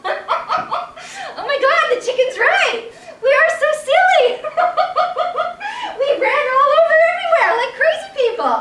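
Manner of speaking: high, loud, speaking through laughter; laughing at himself. Acted. Man in the mob realising his folly, laughing hard. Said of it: "a man started to laugh".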